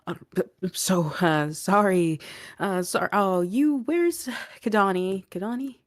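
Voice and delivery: flustered and stammering, pitch climbing; worried. Acted. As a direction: blurt it out loudly, searching anxiously for someone. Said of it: "very worried-looking Adrian".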